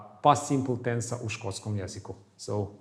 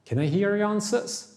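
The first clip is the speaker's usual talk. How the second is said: ordinary talk, nothing marked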